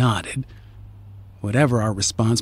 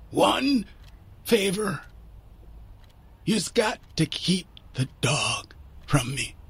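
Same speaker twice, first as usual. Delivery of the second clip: halting, pained plea in Irish brogue; rough, labored dying man; Mister McCaslin; 6 semitones higher, 7 dB quieter than usual. A narrator's reading. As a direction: start high, sink softly, word by word. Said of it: "painful voice".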